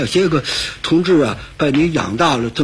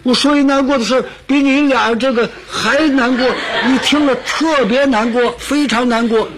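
higher, fast and laughing; excited build-up; 6 semitones higher than usual. Acted. A man promising his friends a sadder story, hyped up with laughter. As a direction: rush it out, pumping up the sad story.

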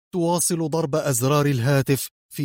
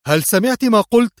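loud, much higher furious snap; savage angry outburst; 10 semitones higher, 6 dB louder than usual. A narrator's reading. Leader cutting off his aide, loud fury. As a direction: cut her off with a savage shout. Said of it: "قاطعها في وحشية ثائرة".

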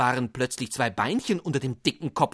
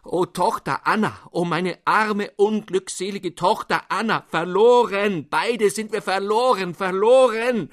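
wailing and screaming; 9 semitones higher, 7 dB louder than usual. Acted. Father wails to his daughter Anna that all is lost. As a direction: howl out the despair. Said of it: "brach er aber heulend und schreiend los".

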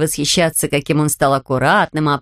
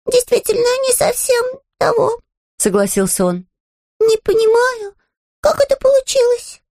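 bear cub voice. Acted. Bear speaking.

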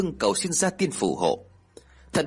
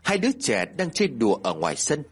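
plain reading voice

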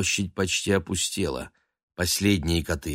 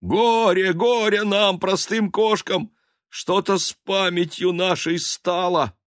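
mock lament; 12 semitones higher, 5 dB louder than usual. Simple cats lamenting their memory, mock high wail. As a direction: wail over the loss.

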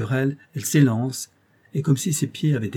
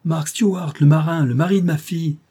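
higher, louder call out; sudden cry; 6 semitones higher, 5 dB louder than usual. Acted. The old mountaineer suddenly calls for his son-in-law, a loud cry. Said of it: "s'écria soudain le vieux montagnard".

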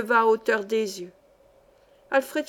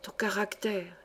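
hushed, much softer, confiding; whispered; 2 semitones lower, 9 dB quieter than usual. A narrator's reading. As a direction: murmur it secretly into his ear. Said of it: "chuchota près de l'oreille". (chuchoté à l'oreille)

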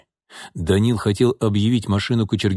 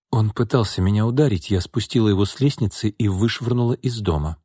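ordinary delivery, nothing marked

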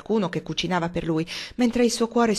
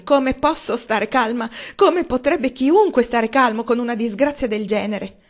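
higher, louder wailing lament; fretful mother in a panic; distraught mother; 6 semitones higher, 5 dB louder than usual. A narrator's reading. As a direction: wail it, hands wringing. Mrs Frederick wringing her hands.

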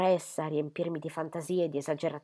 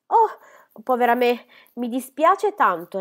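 high, loud, slowed apology; all in a fluster, sorry to have intruded; flustered Miss Phoebe; 6 semitones higher, 8 dB louder, slower than usual. Acted. Miss Phoebe apologising to Osborne, high loud fluster. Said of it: "tutta agitata".

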